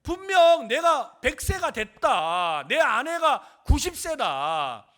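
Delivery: loud, high, slow declamation; forceful preaching. Real, not acted. Preacher voices Abraham's hopeless situation to congregation, forceful preaching. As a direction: proclaim each clause at full force.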